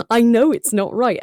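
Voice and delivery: high, drawn-out mock protest; exasperated, comic objection with laughter; insistent self-criticism. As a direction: insist on it, half laughing at yourself. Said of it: "And I was like".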